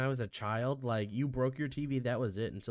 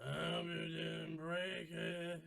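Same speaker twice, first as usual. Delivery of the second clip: distant, off-mic, higher and much softer; from another room; 4 semitones higher, 11 dB quieter, slower than usual. Pretend. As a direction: call out from the next room, far from the mic. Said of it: "I'm gonna back up from the microphone and do his voice".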